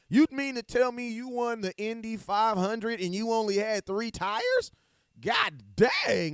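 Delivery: loud, high incredulous exclamation; mock disbelief. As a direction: marvel at it, stretching the punchline. Hyping an unlikely achievement in mock disbelief.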